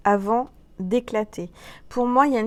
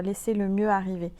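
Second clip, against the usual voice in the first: normal talking voice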